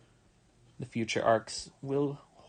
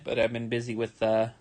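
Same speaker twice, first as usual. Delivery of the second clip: ordinary delivery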